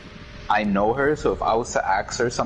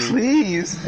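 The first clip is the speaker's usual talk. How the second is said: mock-pleading, higher; 9 semitones higher than usual. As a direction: beg in a higher, exasperated pitch. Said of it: "I be pleading with her".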